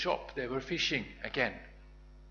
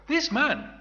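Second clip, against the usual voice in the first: high, loud pointing out; single him out to the crowd; accusing servant girl; 11 semitones higher, 8 dB louder than usual. Acted. Servant girl points Peter out to bystanders, high and loud.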